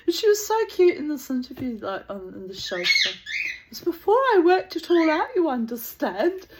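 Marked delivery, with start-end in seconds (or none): 3.7-6.5 higher-pitched mock innocence; Miriam Margolyes. Imitating a cute interviewee being naughty, feigned innocence. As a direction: lightly play all innocent, pitched up and knowingly naughty. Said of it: "acting all innocent".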